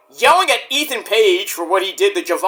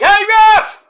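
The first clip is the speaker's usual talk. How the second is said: loud, much higher yelling; a high, loud, desperate yell at the ref; shouting at the ref; 12 semitones higher, 6 dB louder, slower than usual. Retold or imitated. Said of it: "is like".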